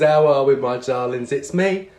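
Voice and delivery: ordinary talk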